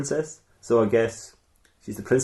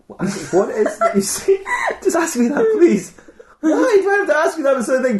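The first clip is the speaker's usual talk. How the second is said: laughing; 3 dB louder than usual. Acted. Witch baffled by request.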